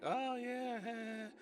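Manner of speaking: high, soft swooning coo; mock flattered. Acting out her flattered reaction.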